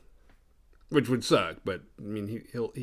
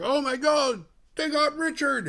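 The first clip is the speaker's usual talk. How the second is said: very high, drawn-out mock wail; mock panicked cry; 3 dB louder than usual. Couple spotting their kidnapped son, mock panic. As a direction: cry out in hammed-up alarm.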